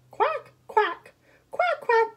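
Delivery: high-pitched quacking; high ducky calls; duck quack. Acted.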